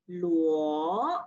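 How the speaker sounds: "luo" rising, second tone. Shown as a demonstration. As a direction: lift "luo" upward. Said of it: "Line number fifteen".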